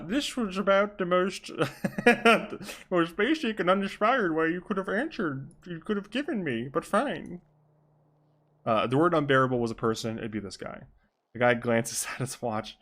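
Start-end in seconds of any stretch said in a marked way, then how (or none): 0.0-7.4 high, affected character voice, cracking up; put-on detective voice. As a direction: struggle through a silly detective voice. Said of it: "I'll do, like, a fucking Bane voice".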